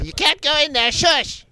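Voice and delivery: high-pitched mock telling-off; scolding auntie. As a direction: shush someone like a bossy auntie.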